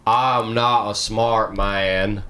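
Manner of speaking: slow, drawn-out Forrest Gump impression. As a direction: drag every word out like Forrest Gump. Quoting Forrest Gump line.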